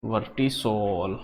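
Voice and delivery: वर्टिसॉल drawn out slowly, syllable by syllable; slow repeat for students. Shown as a demonstration. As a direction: spell out वर्टिसॉल slowly so the class can note it. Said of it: "किस नाम से?". (वर्टिसॉल को धीरे-धीरे, साफ़ बोलना)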